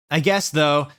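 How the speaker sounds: ordinary delivery, nothing marked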